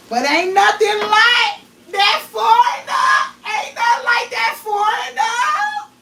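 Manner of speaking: high, loud, slow, drawn-out exclamation; hammed-up joyful declaration; exuberant proclamation. Real, not acted. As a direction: belt it out like a showstopper. Excited praise of foreign men.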